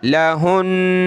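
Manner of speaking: लहुन्ना slow, the doubled न hummed through the nose; nasal ghunna. Shown as a demonstration. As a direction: hold the nasal hum on न in लहुन्ना. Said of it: "यहाँ तो गुन्ना करेंगे". (लहुन्ना में नून पर गुन्ना, नाक से)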